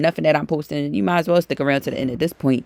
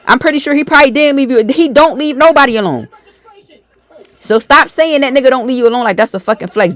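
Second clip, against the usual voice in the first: higher and louder, fired up and scolding; heated loud rant; 8 semitones higher, 7 dB louder than usual. Real, not acted. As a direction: shout her down, hammering each line.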